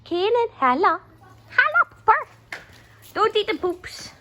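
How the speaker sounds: high cooing pet talk. Real, not acted. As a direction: coo at the puppy up high. Talking to the puppy.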